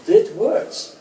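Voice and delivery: ordinary delivery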